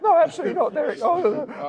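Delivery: loud, very high-pitched refusal; voice shooting up octaves. Retold or imitated. Promoter mimics himself refusing Derek, loud and absurdly high-pitched. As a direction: squeak it out at the top of your range. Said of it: "my voice just went up about eight octaves".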